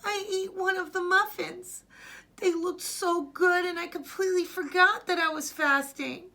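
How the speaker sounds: put-on high, loud distressed child; upset confession. Boy confesses eating a muffin, high and distressed.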